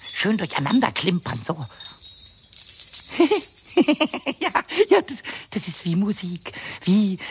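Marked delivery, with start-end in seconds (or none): 2.5-4.3 airy breathless goblin giggle; kobold giggle. Acted. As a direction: giggle with delight at the noise. Kobold enjoying the jingling.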